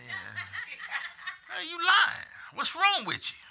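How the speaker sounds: high, loud, laughing scold; mock scolding. Parent scolds a kid caught lying, loud laughing scold. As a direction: call out the fib half laughing.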